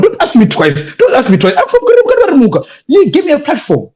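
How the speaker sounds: rising high and loud with eager glee; excited acceptance. Retold or imitated. Eagerly accepting invitation, retold quote.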